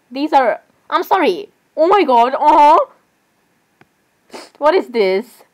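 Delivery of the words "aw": loud, higher cooing aww; adoring aww. Real, not acted. Fangirl looking at plushies, loud adoring aww. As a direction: burst out with a melting "aw". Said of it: "I'm literally saying aw right now".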